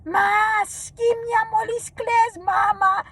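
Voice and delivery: high, drawn-out Greek scolding; hammed-up high wail of reproach; scolding mother-in-law. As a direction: imitate an exasperated mother-in-law laying into someone. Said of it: "My mother-in-law starts with her".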